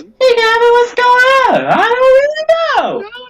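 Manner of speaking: high, cartoonish mock voice; high mocking retake. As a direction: redo the exchange in a silly high-pitched voice. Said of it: "Or I guess it was".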